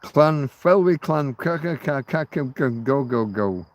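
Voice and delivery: hammed-up fake Welsh place name; teasing Welsh tongue-twister; mock Welsh. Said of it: "who knows how to pronounce anything that's Welsh".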